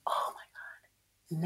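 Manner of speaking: breathy whispered aside. Real, not acted. Remembering the heartbreak, whispered oh my God. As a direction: whisper it in disbelief.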